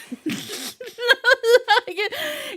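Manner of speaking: long high laugh; high laughter. Real, not acted. Reader laughs at her humorous spirit team, high laughter. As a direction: laugh at the joke.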